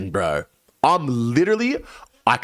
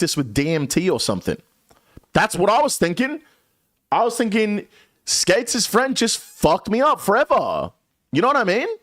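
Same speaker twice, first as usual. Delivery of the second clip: paranoid panic; 7 semitones higher than usual. Retold or imitated. Paranoid retelling, DMT fear.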